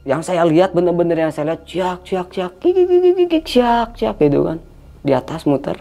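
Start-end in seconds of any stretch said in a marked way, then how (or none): 2.1-4.2 very high squeaky chirps and titters; chirp, jump to a piercing kik-kik, then chirp slower; imitated chick cry. Showing the small kuntilanak circling overhead, imitated chick cry. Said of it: "kayak suara anak ayam".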